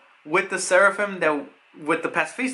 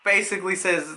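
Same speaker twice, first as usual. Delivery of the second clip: ordinary talk, nothing marked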